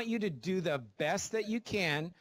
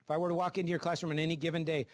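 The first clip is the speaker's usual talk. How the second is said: ordinary delivery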